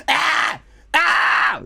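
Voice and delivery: short, high recording-booth yells; high-pitched demo screams; re-enacted yells. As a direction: yell it like another take. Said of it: "how many fucking takes is of".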